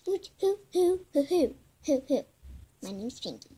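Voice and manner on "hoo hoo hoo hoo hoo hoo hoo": loud hooting. Pretend. Toy hooting.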